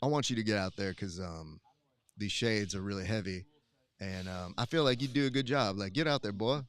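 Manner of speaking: deep, laid-back Southern character voice; Matt Elkins impression. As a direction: drop low, like Brad Pitt playing a Southerner. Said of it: "It's Brad Pitt playing a Southern character".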